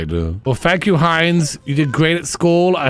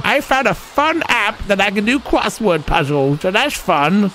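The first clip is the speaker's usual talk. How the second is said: lightly voiced grandma, pleased; a hint of a chirpy old lady; voicing the grandma bragging about her app; Grandma sim voice; 5 semitones higher than usual.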